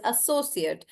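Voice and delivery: "associate" with long SO and short ending; "associate" as the noun, ending "ut"; stress on SO. Shown as a demonstration. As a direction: stretch SO, shrink the end of "associate". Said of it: "the other pronunciation".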